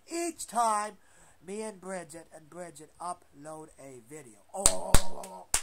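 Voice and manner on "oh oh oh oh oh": loud mock wail of oh. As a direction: wail like an upset fan. Fan wailing.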